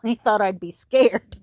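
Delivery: usual speaking voice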